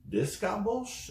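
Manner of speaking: "This Scott Bolsh?" rising very high and slow; the penny drops, disbelieving; slow, high astonished double-take; stunned realization. Retold or imitated. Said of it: "I'm like".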